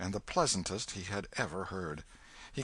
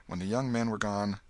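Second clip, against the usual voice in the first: ordinary delivery, nothing marked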